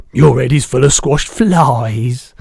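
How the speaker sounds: big, slow, booming giant voice; the giant's voice. Acted. Giant correcting the girl, big booming voice.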